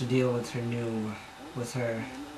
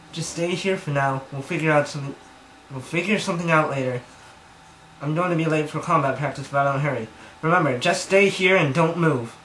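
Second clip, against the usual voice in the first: louder, higher, hurried character line; urgent order; 4 semitones higher, 6 dB louder than usual. Acted. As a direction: rattle off firm instructions in a rush. Voicing Yoko's hurried order to Jay.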